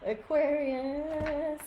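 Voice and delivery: slow, lifted, sing-out Aquarius; drawn-out call. Real, not acted. Card reader calling the next sign, drawn-out and playful. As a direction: stretch Aquarius out long and high.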